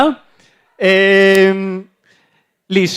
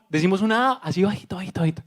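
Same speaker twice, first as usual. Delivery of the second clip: much softer, almost hushed; soft and low; 2 semitones lower, 13 dB quieter, much faster than usual. Shown as a demonstration. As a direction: show them how quietly to say it. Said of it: "cuando vamos acá abajito". (muy bajito, casi en susurro)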